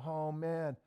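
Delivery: ordinary talk, nothing marked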